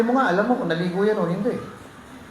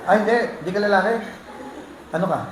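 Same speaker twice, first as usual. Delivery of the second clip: playful teasing; 5 semitones higher, much faster than usual. Real, not acted. Teasing a congregation member.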